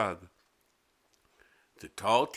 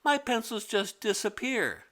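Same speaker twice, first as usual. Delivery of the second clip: put-on much higher, protesting kid; innocent girl voice; 10 semitones higher than usual. A narrator's reading. Mimi insisting to the teacher it's not her fault, high. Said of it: "Mimi continues".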